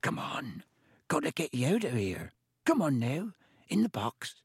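urgent hoarse whisper to a pet. A narrator's reading. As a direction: rasp it out under the breath. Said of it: "speaking in a hoarse whisper".

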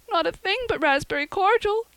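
put-on high, loud tearful girl's cry; sobbing. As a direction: cry it out in anguish. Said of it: "sobbed Anne".

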